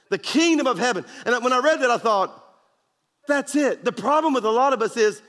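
preaching at full force. Acted.

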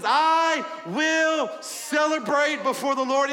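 slow, loud, high proclamation; defiant declaration. Acted. David proclaims to Michal he will celebrate, shouting slowly. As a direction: declare it for all to hear.

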